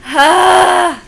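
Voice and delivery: sudden very loud, high "ah"; loud exclaimed cry. Real, not acted. Vlogger alone on camera, a sudden loud cry. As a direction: let out one big high cry.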